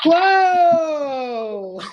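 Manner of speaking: long loud whoa; excited whoa. Acted. Costello whoops to open the cigarette ad, loud. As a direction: shout it in delight.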